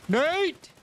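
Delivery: high, loud call across. Real, not acted. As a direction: call his name out loud. Calling teammate.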